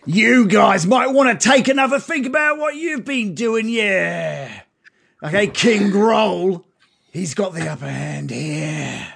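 put-on old bugbear, high and loud; gentle bear person. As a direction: voice the aging bugbear, a touch threatening. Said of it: "the bugbear speaks up".

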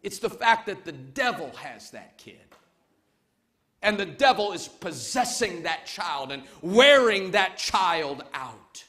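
usual speaking voice

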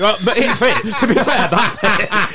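laughing through the words; can't stop laughing while saying it. Real, not acted. Laughing at a mate's fat nickname, laughing hard. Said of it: "laughing at the nickname".

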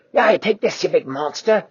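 high, loud taunt; taunting yell. Acted. Quasi jeering at the T-Rex from the tree. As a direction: jeer as you throw.

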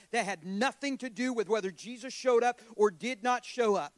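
driving, emphatic testimony that climbs; impassioned preaching. Real, not acted. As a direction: preach it with mounting force. Preaching at rising force.

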